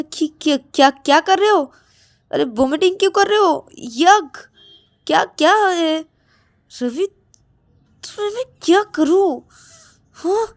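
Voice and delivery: high, exasperated outburst; disgusted scolding. Acted. Rahul appalled at Ravi vomiting. (घिन और झुंझलाहट भरी ऊँची डाँट)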